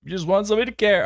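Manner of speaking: high, put-on plea; hammed-up falsetto pleading, hurried at the end; mock plaintive voice. Host echoes the boyfriend's wish for care, mock plaintive.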